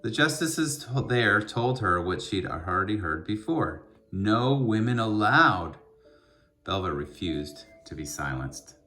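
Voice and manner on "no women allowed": slow, louder and firm; lightly voiced judges shutting the door, slowly; stern refusal. Court refuses women lawyers again.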